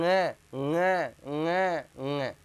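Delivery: ङ ङ ङ said through the nose; nasal nga repeated. Shown as a demonstration. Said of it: "ढंग से उच्चारण". (ङ का शुद्ध नासिक्य उच्चारण)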